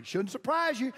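ordinary talk

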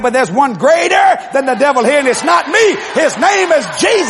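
preacher's loud shout at the devil; ringing shout of faith; shouted command. Retold or imitated. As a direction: proclaim it over the devil. Preacher retells casting out devil.

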